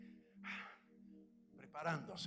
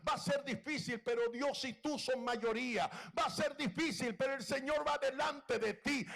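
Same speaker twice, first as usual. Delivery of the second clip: rising louder preaching, repeating the promise; building sermon quote; 6 semitones higher, 8 dB louder than usual. Acted. Preacher voicing encouraging friends, growing louder. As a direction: build it up, each line pushing harder.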